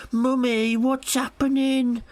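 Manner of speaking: high, slow mock child's whine; squeaky little kid asking mummy; whiny little kid. Imitating a child in the cinema, high whiny voice. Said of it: "being like".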